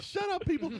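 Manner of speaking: high, loud retold yell; exasperated retort. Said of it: "I was like".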